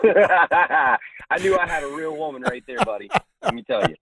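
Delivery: laughing proudly about his wife. Real, not acted. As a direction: laugh it out in delight. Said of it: "laughing while praising wife".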